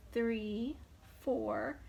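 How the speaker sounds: ordinary delivery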